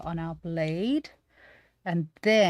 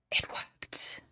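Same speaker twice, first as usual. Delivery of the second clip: amazed, whispered disbelief; awed whisper. Real, not acted. Streamer sees her trim worked, awed whisper.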